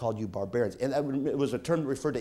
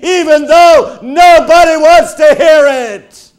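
raised voice; 21 dB louder, slower than usual. Acted. Preaching at full force.

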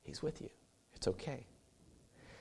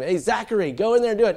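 urging call; 10 semitones higher, 8 dB louder than usual. Retold or imitated. Urging toddler brother.

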